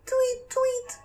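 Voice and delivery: very high chirpy bird voice; bird tweet. Acted. Animals answer in the fog.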